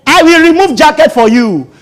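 high, loud picking-a-fight voice; hammed-up threat to roll up sleeves; mock provoked believer. Believer mocked for threatening a rejecter, loud. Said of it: "You don't say".

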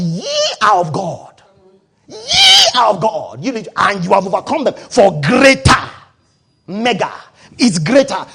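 slow, loud, drawn-out pulpit cry; full-force preaching. Acted. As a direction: preach it out to the whole hall, stretching each word.

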